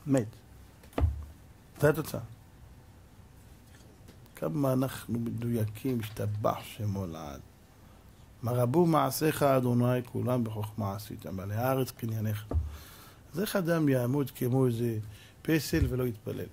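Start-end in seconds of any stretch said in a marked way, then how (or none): 4.4-12.5 reverent hush. Acted. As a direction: let the praise fall to a hush. Reverent praise.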